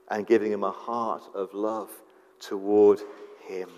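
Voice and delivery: ordinary talk, nothing marked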